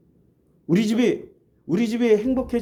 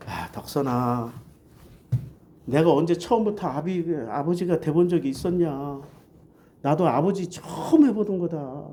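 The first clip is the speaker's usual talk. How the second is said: tender father; 5 semitones lower, 3 dB quieter than usual. Retold or imitated. Father comforting crying daughter.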